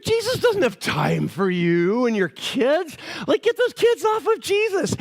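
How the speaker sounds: drawn-out scolding, jumping high at the end; hammed-up officious annoyance; mad disciples. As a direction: shoo the parents off crossly. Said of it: "They get mad at the parents".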